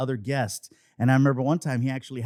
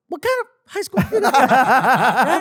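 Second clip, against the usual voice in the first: high, loud, drawn-out disbelief; acted-out incredulous shout; incredulous outburst; 12 semitones higher, 10 dB louder, much slower than usual. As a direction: blurt it out, half laughing. Acted incredulous reaction to manager.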